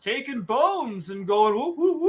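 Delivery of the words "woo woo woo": mock magic whoosh. Mocking evolution as magic glow.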